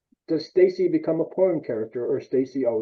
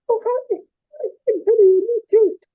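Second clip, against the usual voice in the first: much higher, louder bimbo voice; ditzy airhead voice; 6 dB louder than usual. Retold or imitated. Mocking a smart actress turning ditzy on action. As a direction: squeal it like a fake ditzy girl. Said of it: "the minute you say action".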